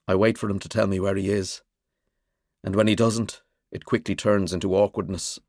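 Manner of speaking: usual speaking voice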